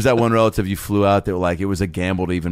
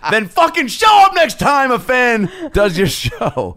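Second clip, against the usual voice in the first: high slow loud mock demand; 14 semitones higher, 4 dB louder, slower than usual. Real, not acted. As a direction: yell it like an ultimatum.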